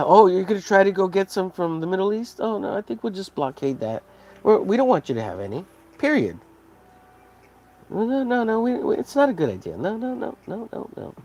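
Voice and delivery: high, mocking salesman voice; smug seller. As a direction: taunt China like a smug shopkeeper. Mock seller taunting China.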